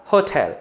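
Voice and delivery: होटल with aspirated ठ; होटल said as होठल, breathy ट; correct pronunciation. Shown as a demonstration. Teacher drilling the right way to say होटल. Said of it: "हो जाएगा होटल".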